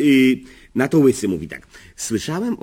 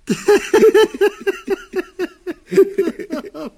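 high, long burst of laughter at his own punchline; delighted laugh at his own joke. Real, not acted. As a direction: laugh hard and high at your own gag.

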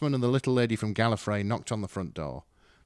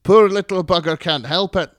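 much higher and louder, yapping terrier voice; grating Scottish mum; 9 semitones higher, 12 dB louder than usual. Acted. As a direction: yap it like a cranky little terrier. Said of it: "Her voice was grating".

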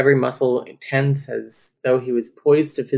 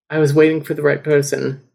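usual speaking voice

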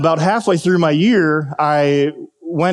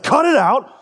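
raised, higher mock yell at a player; retold yell; 10 semitones higher than usual. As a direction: snap it out like an annoyed coach. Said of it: "yells at me".

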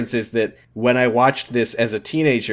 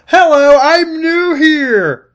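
much higher, slow, hammed-up new-kid voice; mock new girl; 7 dB louder than usual. Mocking a teen who tries too hard to blend in, very high and slow. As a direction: overplay an attention-seeking new girl. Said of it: "You don't blend by going like".

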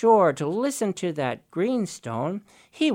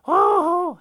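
delighted oh; 14 semitones higher, 7 dB louder than usual. Acted. Raven overjoyed.

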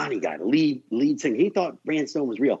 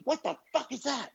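disgusted shock; 10 semitones higher, 5 dB quieter than usual. Retold or imitated. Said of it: "He goes".

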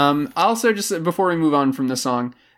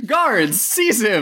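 commanding king, pitched up and laughing; roaring tyrant king; 7 semitones higher, much slower than usual. Acted. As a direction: bark it like a spoiled tyrant king. Playing a king ordering guards.

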